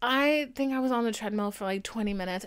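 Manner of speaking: ordinary talk, nothing marked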